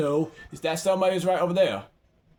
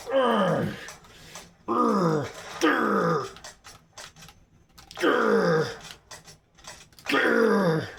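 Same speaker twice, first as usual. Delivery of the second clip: drawn-out falling growls; repeated growls; 3 semitones higher, much slower than usual. Real, not acted. Player in the fight, repeated growls. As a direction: growl "grr" over and over, sliding down.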